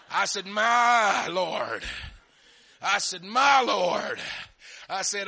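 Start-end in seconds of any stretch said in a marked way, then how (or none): 0.5-1.8 loud, drawn-out "My Lord"; mock-alarmed exclamation. Repeats his reaction to snakes, loudly. 3.2-4.2 loud, long-held MY falling on "Lord"; mock-alarmed shout. Repeats his reaction to snakes, shouting.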